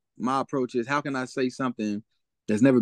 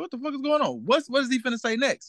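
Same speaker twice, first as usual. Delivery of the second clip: high, bewildered questioning; baffled surprise; 11 semitones higher than usual. Retold or imitated. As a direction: play the confused crowd. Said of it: "It's like".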